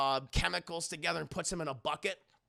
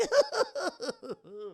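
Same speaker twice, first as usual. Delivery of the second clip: high, gleeful mock villain laugh; Joker cackle; 13 semitones higher than usual. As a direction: giggle wickedly at the fool. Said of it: "But Joker is like".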